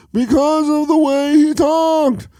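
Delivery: Seinfeld impression. Imitating Seinfeld for a friend. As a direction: drawl it like a standup bit. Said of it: "Or think about Seinfeld".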